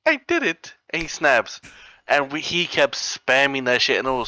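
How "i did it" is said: super high-pitched game character voice; Mister Sashimi. Retold or imitated. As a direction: imitate a squeaky skeleton character. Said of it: "super high pitched too".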